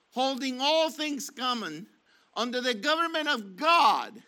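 insistent sermon voice, repeating the government of God; firm pulpit exhortation, slowing on quit saying church; preaching. Acted. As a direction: urge the flock, building on each repeat.

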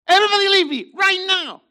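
high, loud furious order; angry shout. Retold or imitated. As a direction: drive everyone out of the room. Joseph sends everybody away.

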